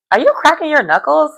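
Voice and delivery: high, disbelieving question; can't believe he's doing it; imitating the fiancée catching him cracking knuckles; incredulous question. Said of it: "And she's like".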